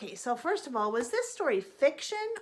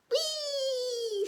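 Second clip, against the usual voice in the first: high delighted squeal; gleeful whee; 13 semitones higher than usual. Acted. A young frog squealing with glee up in the sky. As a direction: squeal with joy on a ride.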